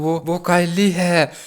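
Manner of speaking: slowed confession breaking into a laugh; halting, amused love confession. Acted. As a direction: draw out the confession, about to laugh. Cracks up at the acted love confession.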